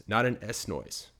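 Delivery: ordinary delivery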